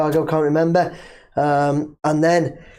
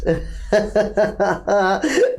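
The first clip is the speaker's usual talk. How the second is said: rising amused laugh; real laugh; 3 semitones higher than usual. Real, not acted. Host laughing at himself for being fooled.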